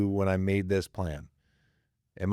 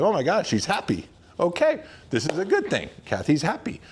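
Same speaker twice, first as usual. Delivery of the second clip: happy realization; 9 semitones higher than usual. Retold or imitated. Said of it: "she was happy".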